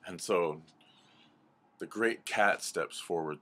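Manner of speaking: ordinary delivery, nothing marked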